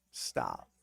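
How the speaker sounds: deep mock voice on Stop. Shown as a demonstration. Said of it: "second stop much lower".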